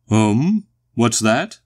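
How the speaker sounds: loud, rising question; booming query. A narrator's reading. Tweety questioning what Phil told him, booming. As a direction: boom the question with a big upward swing.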